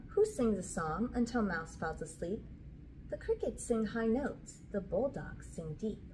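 ordinary delivery

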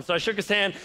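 ordinary talk

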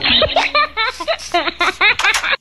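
loud, high burst of laughter; laughing. Real, not acted. Laughs at nonsense in game tour. (tertawa terbahak-bahak)

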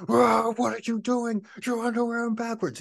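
hammed-up sergeant yell; drill sergeant. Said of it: "he's like".

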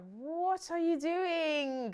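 slow, loud, disbelieving; incredulous. Retold or imitated. Reacting to Jay's pick, loud slow disbelief. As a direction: stretch it out in disbelief. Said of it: "that I was like".

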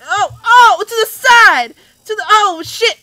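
loud, high panic as the jump goes wrong; panicked yell. Real, not acted. Gamer yelling at a falling raccoon in panic. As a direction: yell out in a sudden scramble.

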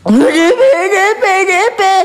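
high startled yelps, real fear; frightened cry. Real, not acted. Spooked friend yelps at something in the dark, high frightened cry. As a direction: cry out the word in panic.

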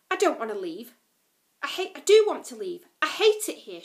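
loud, bitter outburst; spat out. A narrator's reading. Lila spitting out her hatred of the jungle. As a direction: spit the words out in anger. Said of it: "she spat".

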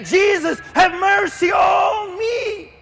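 high, drawn-out cry of repentance; anguished pleading. Acted. Sinner on their knees begs Jesus for mercy, anguished pleading. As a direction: wail it as a desperate confession. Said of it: "go to your knees and say".

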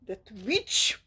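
sharp whispered outburst; whispered "That witch!" rising at the end; whispered exclamation. Real, not acted. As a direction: whisper it with a bite.

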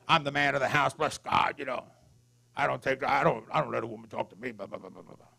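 macho husband. Retold or imitated. Preacher mocking his old machismo to the congregation, macho bluster. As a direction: play a puffed-up man of the house, trailing down fast. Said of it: "I had a problem with machismo".

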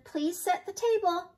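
high, lilting request; calling out. A narrator's reading. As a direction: call out a chore to the child. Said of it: "called Grandma Nan".

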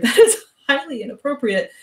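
half-laughing indignant sputter. A narrator's reading. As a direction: sputter it out, caught off guard. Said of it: "Derek sputtered".

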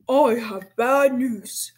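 loud, slow principal's announcement; loudspeaker announcer. A narrator's reading. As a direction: boom it out over the school speaker. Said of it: "a voice came from the loudspeaker".

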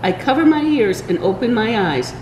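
plain reading voice

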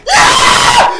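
extremely high, loud shriek; triumphant scream. Real, not acted. Sudden triumph in game.

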